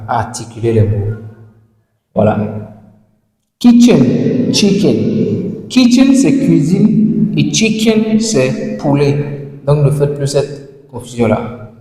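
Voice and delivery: usual speaking voice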